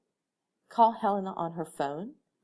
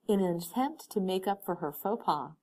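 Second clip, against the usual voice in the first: ordinary delivery, nothing marked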